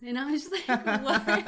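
loud laugh into a stunned what; laughing disbelief. Real, not acted. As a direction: crack up in disbelief. Laughing at absurd news.

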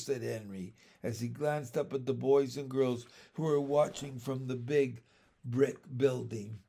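ordinary delivery, nothing marked

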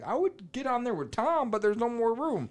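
high little cartoon fly voice; squeaky-high complaining bug; talking fly. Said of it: "they were talking like".

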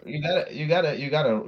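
usual speaking voice

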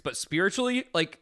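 high-pitched disbelief; exclaiming. Real, not acted. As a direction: shriek what the fuck, then settle down. Mock outrage over lost reward.

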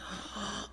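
re-enacted sharp gasp; soft, sudden intake of breath; shocked gasp. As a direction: gasp as if just hit. Re-enacts gasp after punch.

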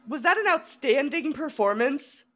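ordinary talk

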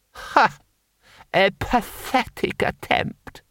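scornful lord. Acted. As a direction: laugh off a feeble trick. Lord Hong scoffs.

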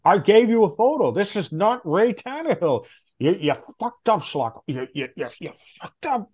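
retold yelling. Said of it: "he was, like, yelling at me".

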